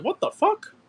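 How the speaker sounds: put-on a startled teen blurting it out; shocked outburst. Student hearing teacher's complaint, shocked high outburst. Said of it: "I was like".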